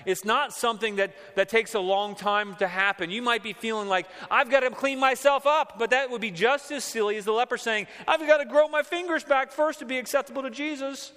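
impassioned preaching; preaching at full force. Acted. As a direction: urge the congregation passionately.

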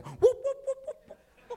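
very high, repeated comic whooping; imitated war whoop. Preacher imitating his half Cherokee grandma, whooping. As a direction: whoop in short high bursts. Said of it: "'Cause she'd be there".

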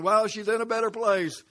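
high, loud quote of the lady, fed up; exasperated loud repeat. Retold or imitated. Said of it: "the lady told me it again".

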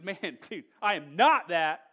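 loud, laughing denial shout; emphatic denial. Retold or imitated. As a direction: bark it out, totally denying.

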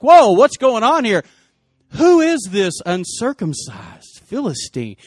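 loud, high indignant outburst, slowing at the end; outraged challenge. Retold or imitated. David hearing Goliath defy God's armies, loudly indignant. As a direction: burst out in acted indignation.

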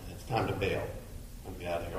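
muttered to yourself. Retold or imitated. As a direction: mumble it as an inner thought. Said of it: "when you say to yourself".

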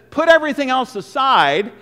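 ringing pulpit call; preaching emphasis. Acted. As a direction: proclaim it at full force. Preacher driving home the point.

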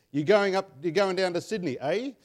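put-on a playful Queensland accent with a rising eh at the end; hint of Queensland accent; mock Queenslander talk. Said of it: "if you're a Queenslander".

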